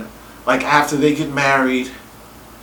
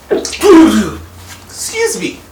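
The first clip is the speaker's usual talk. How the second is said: sneezing; 9 semitones higher, 6 dB louder, much slower than usual. Real, not acted. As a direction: sneeze mid-word. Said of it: "I'm about to sneeze".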